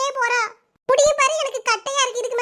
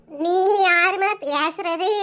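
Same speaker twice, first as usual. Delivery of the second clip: put-on slow, lower, puzzled old granny on the phone; old grandmother; 4 semitones lower, much slower than usual. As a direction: drag each word like a confused grandma.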